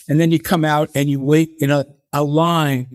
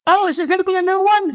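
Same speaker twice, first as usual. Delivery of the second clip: much higher and fast, excited; hammed-up eager fan voice; eager mock question; faster than usual. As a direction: blurt it out hopefully. Voice in commercial asks.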